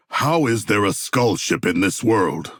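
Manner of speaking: usual speaking voice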